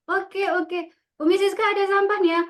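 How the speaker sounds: put-on coaxing a child to help; cheerful teacher demo. Shown as a demonstration. Teacher coaxing a reluctant pupil during cleanup, bright and quick. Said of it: "Biasanya siswa kayak gini".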